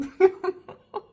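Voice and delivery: high, loud burst of laughter; a real laugh at her own tears; embarrassed giggle through tears; laughing at herself. Real, not acted. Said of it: "now I'm laughing at myself".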